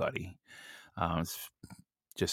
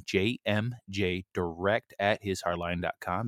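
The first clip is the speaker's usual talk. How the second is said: slow, spelled-out repeat; slow and clear. Real, not acted. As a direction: say it slowly so they can write it. Repeating email address slowly.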